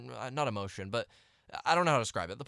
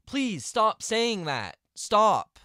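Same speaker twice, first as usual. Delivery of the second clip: higher, louder exasperated plea; 5 semitones higher, 6 dB louder, much slower than usual. Real, not acted. Vlogger fed up with people misusing a word, exasperated. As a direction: plead loudly, at your wit's end. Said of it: "it drives me insane".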